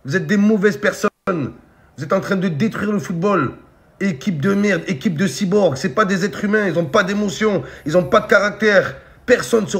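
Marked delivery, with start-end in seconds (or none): none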